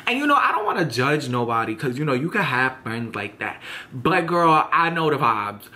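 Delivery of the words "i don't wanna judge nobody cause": normal talking voice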